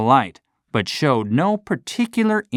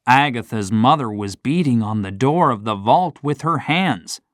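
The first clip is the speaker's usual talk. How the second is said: usual speaking voice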